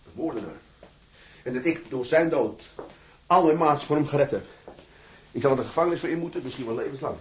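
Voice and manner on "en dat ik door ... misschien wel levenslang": louder and higher, building; rising agitation. Acted. Prisoner in his cell, swearing to accuse Kwel, rising agitation. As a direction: voice climbing as he works himself up.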